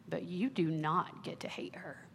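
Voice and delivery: whispered and slow, tender but firm; gentle whisper. Retold or imitated. Father whispering firmly to his crying daughter. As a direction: lean in and whisper it.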